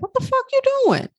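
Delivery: high, fast mocking inner voice; brain voice. Her brain scolding her about leaving a safe job, high and fast. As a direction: squeal it like a panicked brain. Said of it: "my brain was like".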